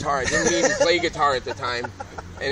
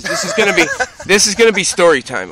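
loud announcement; 11 dB louder than usual. Real, not acted. Announcing story time to friends.

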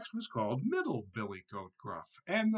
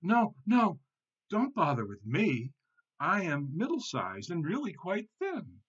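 higher, urgent goat protest; frightened pleading; 7 semitones higher, 5 dB louder than usual. A narrator's reading. Middle goat begs the troll to spare him, frightened pleading. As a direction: beg the troll off. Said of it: "began to shake and tremble".

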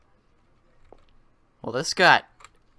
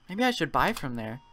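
ordinary talk

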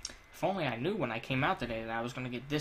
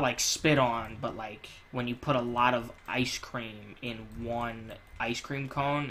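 ordinary talk

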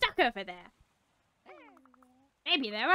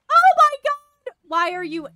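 real alarmed yelp; startled outcry; 13 dB louder than usual. Real, not acted. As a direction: cry out in shock. Startled during game.

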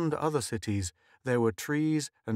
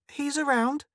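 put-on high-pitched child dodging the question; evasive. A narrator's reading. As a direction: answer vaguely in a high little-girl voice. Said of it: "said Mink evasively".

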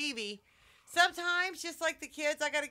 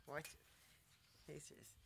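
whispered, very soft; barely voiced conspiratorial aside; hushed aside; 7 semitones lower, 18 dB quieter, much slower than usual. Real, not acted. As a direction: confide it in a whisper. Confiding her plan to the viewers.

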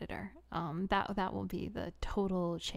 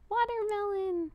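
high, loud delighted shout of the word "Watermelon"; a thrilled thank-you yelp of "Watermelon"; excited cry; 12 semitones higher, 5 dB louder than usual. Real, not acted. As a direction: squeal "Watermelon" out with joy. Viewer sends a watermelon gift.

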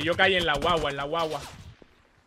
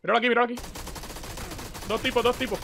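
excited callout; 8 semitones higher, faster than usual. Real, not acted. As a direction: point them out fast, voice up. Spotting enemies in firefight.

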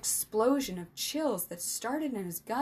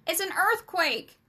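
high, loud shout of alarm; alarmed yell over a comm; 9 semitones higher, 7 dB louder than usual. A narrator's reading. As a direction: shout a warning to friends. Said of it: "Sam yelled into his comm".

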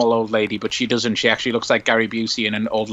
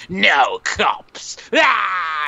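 mock villain shout; 4 semitones higher, much slower than usual. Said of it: "and he's like".

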